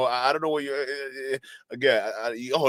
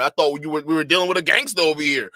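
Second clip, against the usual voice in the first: usual speaking voice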